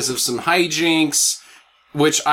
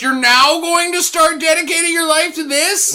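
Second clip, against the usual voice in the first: high, mock-astonished question; mocking incredulous professor; 13 semitones higher than usual. Friend mocks the professor's line with fake disbelief. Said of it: "and the professor says".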